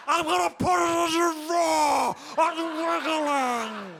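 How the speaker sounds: high, loud, slow creepy creature shrieks; hammed-up Gollum voice. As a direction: screech like Gollum calling for Frodo. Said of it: "make it sound like Gollum".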